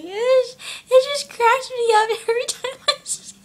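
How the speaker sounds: high, squeaky talk through real laughter; real giggles breaking into the words; laughing hard. Real, not acted. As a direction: try to speak while cracking up. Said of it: "it just makes me laugh a lot".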